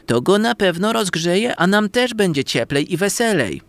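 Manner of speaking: high, cheery pan; chirpy kitchen pan coaxing friends; frying pan voice. A narrator's reading.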